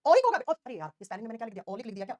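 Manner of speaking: usual speaking voice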